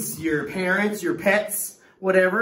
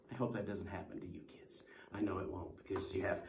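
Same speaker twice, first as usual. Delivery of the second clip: whisper; 10 semitones lower, 18 dB quieter, faster than usual. Real, not acted. Aside to kids after parody.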